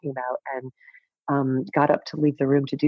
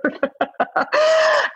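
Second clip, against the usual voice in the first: a high, bright burst of real laughter; 5 dB louder than usual. Real, not acted. Laughing at her own breakup story. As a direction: laugh out loud at yourself.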